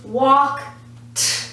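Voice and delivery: "walk", then a separate voiceless "t"; sounded-out ending. Shown as a demonstration. Teacher explaining past tense spelling, sounded-out "walk ... t". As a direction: split off the "t" after "walk". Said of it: "but the sound is walk".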